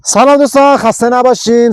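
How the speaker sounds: bright, loud hello to the channel; high, loud upbeat welcome; energetic greeting. Real, not acted. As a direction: greet the viewers with a burst of energy. Energetic opening greeting to viewers.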